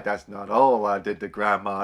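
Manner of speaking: mock menacing Bob taunting Vanessa; menacing villain. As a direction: growl it out like a leering killer. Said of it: "And he's like".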